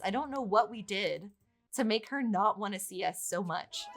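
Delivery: ordinary talk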